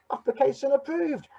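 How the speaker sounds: high-pitched, delighted announcement; triumphant approval. Acted. Duck accepts tortoise into club.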